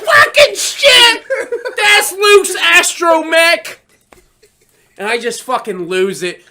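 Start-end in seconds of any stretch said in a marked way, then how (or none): 0.0-3.8 slow, high shouted sobbing; hammed-up tearful fan shriek; mock uncontrollable bawling. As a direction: wail each word as if bawling. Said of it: "bawling uncontrollably".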